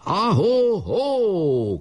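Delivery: long drawn-out high halloo; high, slow holler stretched out; shouting. A narrator's reading. Boy answers his leader's order, long high call. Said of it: "kiáltott Kolnai".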